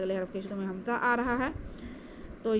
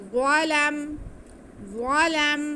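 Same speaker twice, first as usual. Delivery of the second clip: slow, drawn-out melodic tajweed recitation, higher and louder; Quran recitation; 5 semitones higher, 5 dB louder, much slower than usual. Shown as a demonstration. Teacher demonstrating the recitation to students, slow melodic chant. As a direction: chant it slowly with nasal ghunna. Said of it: "लाम को हम मीम के साथ मिलाएंगे".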